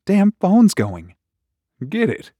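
a wife half asleep, pushing him to answer; sleepy wife. Acted. Sleepy wife voiced in story.

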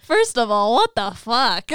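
loud, exclaimed through laughter; laughing disbelief. Real, not acted. Host reacts to the story's twist, laughing in disbelief. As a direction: blurt it out and crack up.

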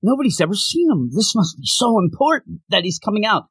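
high-pitched, astonished crowd reaction; surprised onlookers. Retold or imitated. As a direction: imitate amazed viewers, pitch way up. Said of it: "everybody's surprised".

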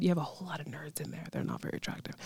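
low, whispery confession under the breath; hushed aside. Real, not acted. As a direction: drop to a whisper, confidentially. Confidential aside about classmates.

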